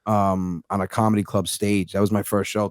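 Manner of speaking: normal talking voice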